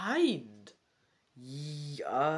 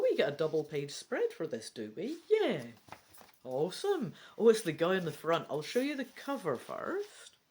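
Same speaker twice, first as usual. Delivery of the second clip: ordinary talk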